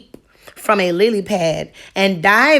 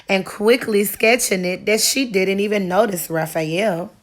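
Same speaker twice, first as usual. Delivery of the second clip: plain reading voice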